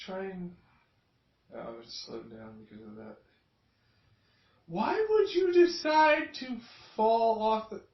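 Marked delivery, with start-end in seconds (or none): 4.7-7.8 incredulous. Real, not acted. Incredulous at fight video.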